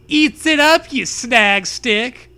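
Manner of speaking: high, loud, then very slow; mock-menacing Zoe voice; hammed-up Zoe. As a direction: drag out the insult slowly.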